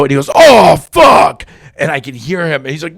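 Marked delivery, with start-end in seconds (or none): 0.3-1.4 high, loud cry of dismay; mock-horrified yell, drawn out; retold horrified shout. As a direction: blurt it out in loud disgust. Retold horrified bedroom discovery.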